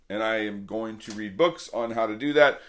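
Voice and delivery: ordinary talk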